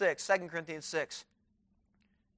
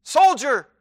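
stern commanding; 9 semitones higher, 15 dB louder than usual. Acted. Commander repeats the question.

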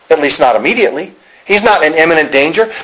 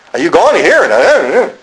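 exaggerated up-and-down lilt on "Are you going to hear it"; sing-songy; 4 semitones higher than usual. Mocking a Pennsylvania accent, "Are you going to hear it" in sing-song. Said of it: "you speak with that sing-songy".

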